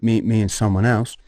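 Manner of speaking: normal talking voice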